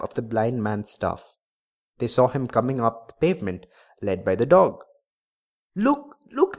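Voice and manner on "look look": high-pitched cry of alarm to onlookers; excited cry. A narrator's reading. Onlookers spot the blind man's return, crying out excitedly. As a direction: exclaim it high, pointing others to the sight. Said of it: "they cried".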